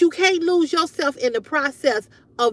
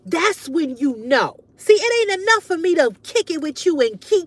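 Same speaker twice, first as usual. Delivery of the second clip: ordinary talk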